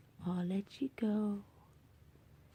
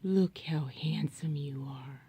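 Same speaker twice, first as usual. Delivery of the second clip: ordinary talk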